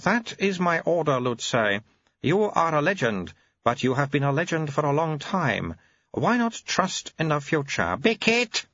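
put-on very high, babyish old abbot voice; an ancient mind in a baby's mouth; high piping infant abbot; baby-bodied old abbot. Abbot gives order.

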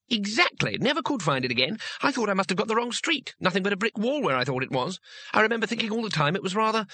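high-pitched, enthusiastic little man; eager tourist, excited. A narrator's reading.

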